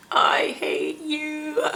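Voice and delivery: high, drawn-out pained growl at husband; hammed-up, slow, strained complaint; mock agonized resentment. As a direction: drag every word out through imitated labor pain. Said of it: "I'm cringing onto my husband like".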